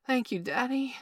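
high, loud grateful cry. A narrator's reading. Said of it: "Bailey cried".

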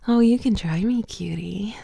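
ordinary talk

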